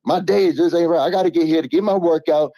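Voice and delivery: high, excited radio host; hyped host voice. Acted. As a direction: pump up the crowd like a morning show host. Hyped announcer-style shout-out.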